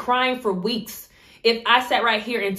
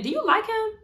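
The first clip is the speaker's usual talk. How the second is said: teasing challenge; 13 semitones higher than usual. Retold or imitated. Confronting friend about crush.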